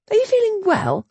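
high, concerned; lightly voiced heroine. Governess to pale duke, high and concerned. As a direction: ask with gentle worry.

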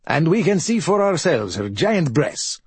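lightly voiced old villager; old fisherman voice. Old fisherman's quoted line.

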